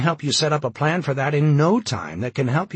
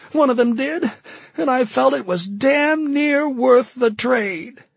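sobbing; 7 dB louder than usual. A narrator's reading. Carol begging the colonel to let her stay, sobbing. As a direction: plead through sobs. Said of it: "she sobbed".

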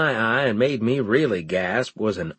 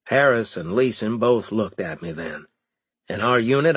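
ordinary delivery

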